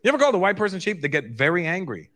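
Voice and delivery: normal talking voice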